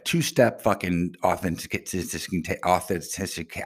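stumbling over "authentica-" in garbled syllables; tongue-tied. Real, not acted. Angry caller recounting two-step login trouble, tongue-tied on "authentica-". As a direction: trip over "authentica-" and fail to get it out. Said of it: "I can't even think of words right now".

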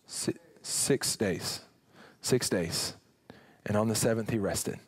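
low, soft, slow awed hush; hushed awe. Real, not acted. Preacher hushes congregation about six days, soft and low. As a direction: let it land in a near whisper. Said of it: "dramatic hush over creation".

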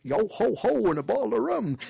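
hearty sea-shanty pirate chant. Pretend. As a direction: belt it like a jolly buccaneer. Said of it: "You're a pirate".

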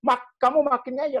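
high, loud exasperated scolding; scolding parent. Retold or imitated. Parent scolding a stubborn child. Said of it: "bapakne langsung bilang".

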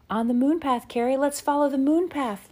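high, loud joyful call; excited cry. Acted. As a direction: cry it out with delight. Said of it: "Laura cried".

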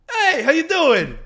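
high, slow, sing-song hello; a breezy high welcome at the door; cheery greeting. Retold or imitated. Salon owner greets visitor, high and drawn out.

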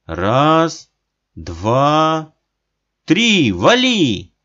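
громкий отсчёт, последнее слово высоко и протяжно; отсчитать криком для прыжка; кричит. A narrator's reading. Отец кричит. (громкий отсчёт)

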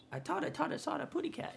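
very high, soft cartoon bird voice; Tweety. Dazed kid seeing stars after a punch, tiny cartoon bird voice. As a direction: squeak it softly in baby-talk. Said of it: "I saw the little Tweety birds".

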